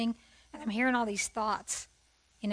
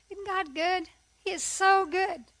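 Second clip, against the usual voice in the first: joyful praise; 7 semitones higher, 6 dB louder, slower than usual. Real, not acted. Praising God mid-testimony.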